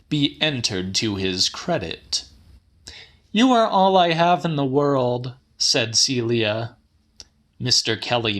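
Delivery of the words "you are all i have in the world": put-on higher, quicker young woman's plea; lightly voiced young woman, pleading fast; Celia's voice. A narrator's reading. Granddaughter Celia pleading with her grandfather, higher and quicker.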